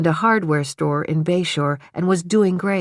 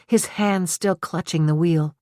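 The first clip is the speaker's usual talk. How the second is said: ordinary delivery, nothing marked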